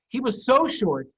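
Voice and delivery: plain reading voice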